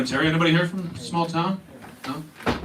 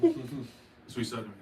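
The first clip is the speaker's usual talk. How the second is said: ordinary talk, nothing marked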